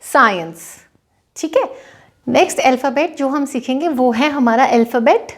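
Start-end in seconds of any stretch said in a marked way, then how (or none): 0.0-0.6 साइंस with the c silent, "sai-ns"; साइंस as a teacher's model word. Shown as a demonstration. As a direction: say साइंस clearly with a falling close. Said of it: "एस को स की sound के साथ बोलेंगे".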